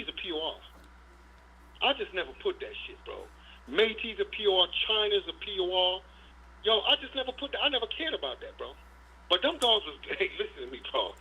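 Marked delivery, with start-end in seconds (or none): none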